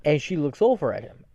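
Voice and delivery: normal talking voice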